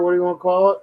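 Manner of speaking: ordinary delivery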